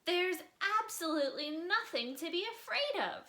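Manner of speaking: high, loud, fed-up parent scolding; exasperated parents. Acted. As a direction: scold like tired parents at bedtime. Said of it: "they told him".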